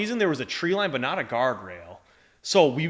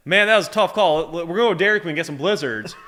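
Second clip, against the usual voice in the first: cheerful, loud, higher Southern buddy call; loud country buddy; 6 semitones higher, 7 dB louder than usual. Retold or imitated. Bubba cheering up the crew after a tough call, loud. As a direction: whoop it up like a good ol' boy. Bubba announcing ice cream.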